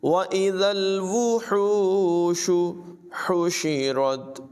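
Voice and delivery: slow chanted tajweed recitation; Quran recitation. Shown as a demonstration. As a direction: recite it melodically, drawing out the vowels. (धीमी, सुरीली क़ुरान तिलावत)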